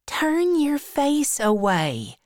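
put-on very high girlish voice; high, coy girl giving an order; Becky, shy schoolgirl. A narrator's reading. Becky telling Tom to look away, high shy girl's voice.

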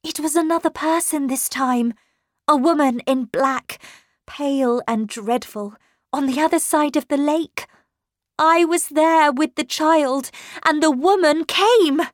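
high, loud, urgent retelling; agitated account. A narrator's reading. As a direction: report the apparition in alarm. Governess recounts frightening apparition.

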